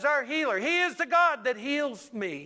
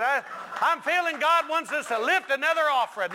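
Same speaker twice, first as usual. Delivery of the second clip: higher-pitched imitation of an offering appeal; offering-time preacher; 6 semitones higher, 4 dB louder than usual.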